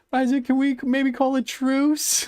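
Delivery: high mocking imitation of a pleading rival; mock pleading victim. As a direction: squeak it out like a beaten troll target. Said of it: "He's like".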